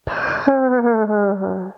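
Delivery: rolling purr like a cat. Shown as a demonstration. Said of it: "One more".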